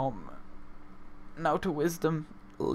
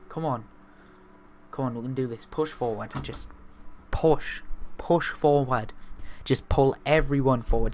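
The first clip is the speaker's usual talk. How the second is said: ordinary talk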